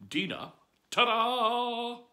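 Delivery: slow, high, loud fanfare; ta-da reveal. A narrator's reading. Narrator unveiling Dina to listeners with slow fanfare. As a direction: present the name like a magic trick.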